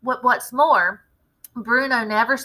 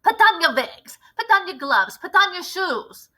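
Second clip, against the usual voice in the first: loud, higher barked commands; shouted orders; 9 semitones higher, 5 dB louder than usual. Acted. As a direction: hurry the witches into disguise. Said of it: "shouted the Grand High Witch".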